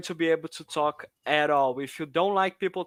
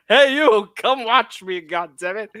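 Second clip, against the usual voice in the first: mock shout; 5 semitones higher, 8 dB louder, slower than usual. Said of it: "so like".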